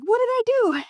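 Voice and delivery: mock dismay. Rejected business owner protesting to Google, mock dismay. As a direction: imitate a baffled, protesting client with pitch jumping way up.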